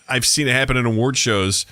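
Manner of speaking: ordinary delivery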